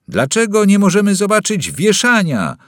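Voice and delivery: high, loud demanding roar; full-voiced roar. Boy demanding to see the hanging, roaring. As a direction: bellow the demand. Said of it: "ryknął chłopiec na cały głos".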